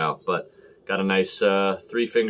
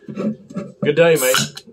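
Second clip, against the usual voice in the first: hammed-up Aussie accent, pitched up; mock Australian greeting; 4 semitones higher, 4 dB louder, much slower than usual. Reviewer about to drink a Foster's, in mock Australian accent. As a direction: toast in a high fake Australian accent.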